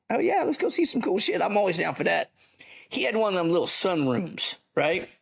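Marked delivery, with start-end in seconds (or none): 0.1-2.3 much higher, eager oh yeah; eager yes. Retold or imitated. To the sergeant major offering to show him something, eager and high. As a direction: jump at the offer. Invited to see cool stuff.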